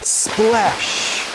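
high, punchy splash; big splash. A narrator's reading. Reader calling out the splash to kids, excited. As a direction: make it land like a jump. Story climax sound word.

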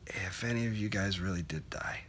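soft, near-whispered admission with long pauses; quietly admitting a frightening thought; hushed, troubled confession. Acted. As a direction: let it drop almost to a whisper.

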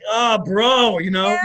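overjoyed, greeting a devoted fan; touched bro. Retold or imitated. His touched reply to the fan.

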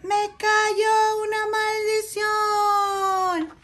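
high, long-drawn storybook wail; dragon's shriek. A narrator's reading. Irate dragon screeches at his bad luck, slow high wail. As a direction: wail it out high, stretching every word. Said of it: "Un dragón colérico chilla".

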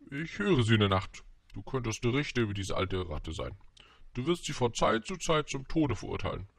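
king voice starting high, then settling; scheming king. Acted. King offers the prince a judge's job, animated royal persuasion. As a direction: propose it like an improvising monarch.

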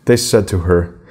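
plain reading voice

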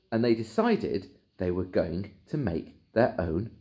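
ordinary delivery, nothing marked

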